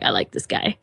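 low, dry mock approval; approving Bucky. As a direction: drop the voice low and nod along.

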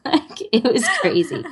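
laughing. Real, not acted. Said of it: "laughing about the noisy house".

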